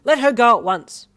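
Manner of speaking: high loud order; stern loud command. Acted. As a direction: order him to stop. Customer intervening.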